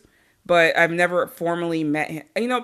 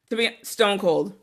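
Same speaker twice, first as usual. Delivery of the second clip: usual speaking voice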